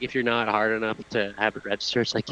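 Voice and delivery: usual speaking voice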